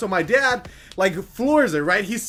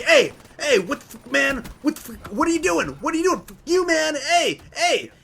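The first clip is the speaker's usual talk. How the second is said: mock angry yelling, raised and higher; half-hammed angry shout from the truck; retold yelling; 4 semitones higher than usual. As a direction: imitate the truck guys hollering at them. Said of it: "they start yelling".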